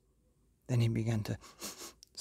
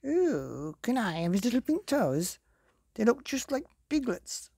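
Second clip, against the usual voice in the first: high, eager little wolf; 11 semitones higher than usual. Acted. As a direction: squeak it out like a greedy pup. Little Wolf speaks, story voice.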